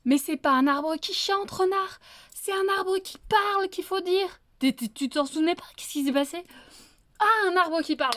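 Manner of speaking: wolf losing patience with the fox; exasperated wolf. Acted. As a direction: scold a forgetful friend, voice rising. Wolf scolds fox for wrong words.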